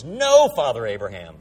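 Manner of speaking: loud, emphatic mock defiance; belligerent, emphatic. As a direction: refuse forcefully as the angry rich man. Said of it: "He becomes angry".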